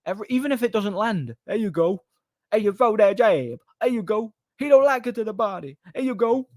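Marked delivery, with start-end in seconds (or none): 1.4-6.5 much higher, sing-song cornerman encouragement. Retold or imitated. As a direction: cheer each jab like a cornerman.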